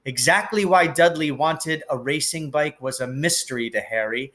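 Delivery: usual speaking voice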